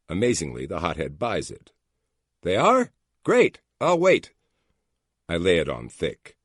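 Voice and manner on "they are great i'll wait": much higher, louder eager reply; suddenly pleased, all ears; eager relief. A narrator's reading. Hotheaded agent on the phone, suddenly eager. Hothead buying the fake story.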